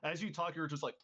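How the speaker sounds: ordinary talk, nothing marked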